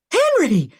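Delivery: high, loud scream of a name; loud anguished scream. Acted. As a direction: scream toward the explosion. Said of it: "she screamed".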